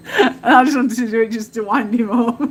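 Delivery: laughing through the words; giggling as she admits the wind-up; real laughter breaking through. Real, not acted. Laughing while telling gnome story.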